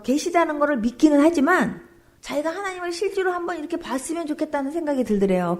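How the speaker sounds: normal talking voice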